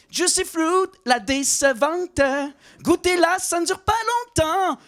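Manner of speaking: high, slow ad announcer; honest ad voice. Pretend. Comedian doing a mock honest gum commercial, high ad voice. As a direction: pitch the gum like a TV ad. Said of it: "Il aurait dû être honnête dans l'annonce".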